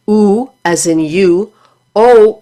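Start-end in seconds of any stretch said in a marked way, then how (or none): 0.1-0.5 "oo" held as in "you"; "oo" as a long, isolated vowel demo; rounded vowel. Shown as a demonstration. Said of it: "for the rounded vowels". 1.9-2.4 "o" said long, as in "go"; "o" as a slow, isolated vowel demo; rounded vowel. Shown as a demonstration. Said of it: "as in go".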